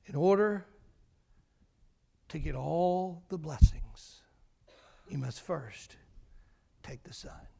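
slow, then dropping to a whisper; fading to a whisper. Acted. Preacher gives the lesson to the congregation, fading to a whisper. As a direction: let the last words vanish into a whisper.

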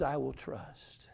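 slow, soft, almost breathed-out vow; hushed trust. Acted. Preacher to his congregation, quoting David, slow and soft. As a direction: let the words sink in, slow and soft.

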